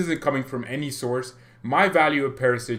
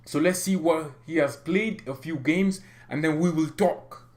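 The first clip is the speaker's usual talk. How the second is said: higher-pitched mock French accent; 4 semitones higher than usual. Reading the club chairman's statement to viewers in a French accent. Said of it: "I'm gonna do a French accent".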